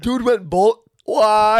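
high, loud disbelief; surprised. Real, not acted. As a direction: cut off in shock. Disbelief at bowling story.

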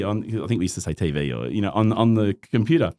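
ordinary talk

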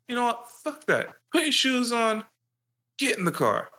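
high mock dad ordering; hammed-up furious father barking orders; angry dad. Dad ordering his son into the car, high mock yell. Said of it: "He's like".

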